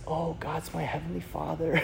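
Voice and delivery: mock soft-spoken answer. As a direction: hush it down gently like the big guy did. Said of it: "like a soft voice".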